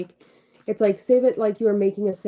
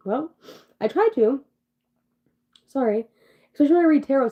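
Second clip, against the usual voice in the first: higher, louder and more upbeat; perkier on request; 6 semitones higher, 4 dB louder than usual. Real, not acted. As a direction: lift the energy, answering her own nag. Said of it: "why don't you speak with some more fucking, like, excitement in your voice".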